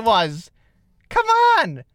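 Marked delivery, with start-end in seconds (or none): 1.1-1.8 high, pleading protest; high-pitched plea. Real, not acted. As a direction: beg him to spread love. Pleading with co-host.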